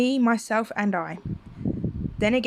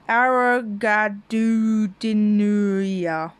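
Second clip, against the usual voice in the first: slow drawn out; 4 dB louder, much slower than usual. Acted. Reads made-up language name.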